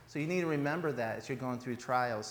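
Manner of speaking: ordinary talk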